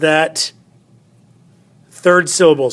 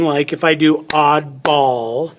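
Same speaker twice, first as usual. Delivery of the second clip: ordinary delivery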